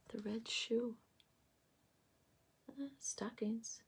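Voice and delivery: softly whispered aside. Real, not acted. Tarot reader repeating card details to herself, whispered. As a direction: breathe the words as if to herself.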